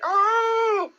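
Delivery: loud high-pitched whoop going up; high "ooh". A narrator's reading. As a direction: squeal as you are bounced up. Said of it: "as he went up in the air".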